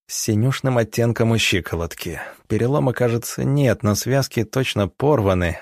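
ordinary delivery, nothing marked

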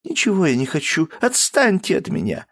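high voice pushing them away; a hint of a sulking girl; upset daughter. Daughter refusing from behind the door. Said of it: "послышался голос за дверью".

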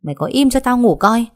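put-on groggy young master yelling through the door; irritated shout. A narrator's reading. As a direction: bark at her to shut up and let him sleep. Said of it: "Long bực mình quát lớn".

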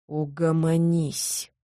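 soft, slow reprimand; hissed. A narrator's reading. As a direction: hiss it slowly to silence someone. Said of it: "прошипел он".

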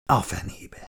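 soft, breathy-free hushed curse; quiet dying curse; whispered curse. A narrator's reading. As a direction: mutter it under the breath.

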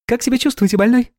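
high, sweet chirpy nurse voice; chirping. A narrator's reading. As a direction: chirp it like a cartoon princess. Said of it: "прощебетала девушка".

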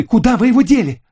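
very high, loud raging demand; furious shriek. A narrator's reading. Lucille demands the swimsuit back, furious shriek. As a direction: scream the question in fury. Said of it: "в бешенстве воскликнула она".

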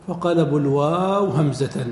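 ordinary talk

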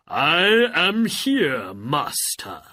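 booming, slow genie voice. Acted. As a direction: speak as a grand genie. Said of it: "said the genie of the lamp".

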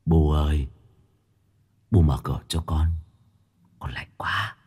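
low, fading, doleful ghost plea; gloomy ghost voice. Acted. Ghost repeating its plea at the door, low gloomy moan. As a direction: let it fade out like a cold spirit. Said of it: "lặp lại âm u".

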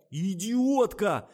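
high, loud, long-drawn outburst; furious insult. A narrator's reading. Adman shoves the makeup girl back, furious. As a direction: hurl the insult, stretching it out. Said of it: "зашипел рекламщик".

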